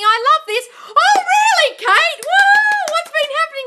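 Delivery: very high, loud, laughing squeal; over-the-moon squeal for Kate; squealing with delight. Real, not acted. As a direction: shriek with joy at the good news. Thrilled reading viewer's success.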